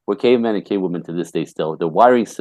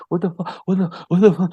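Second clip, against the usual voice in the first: high, shocked mock panic; husband hit by the divorce news, panicked; panicked disbelief; 10 semitones higher than usual. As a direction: blurt it like a stunned husband. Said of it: "all say".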